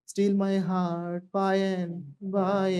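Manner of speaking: each word stretched out slowly; slow drill. Shown as a demonstration. Teacher drilling the class, very slowly. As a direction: draw out every word for the class. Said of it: "बोलो".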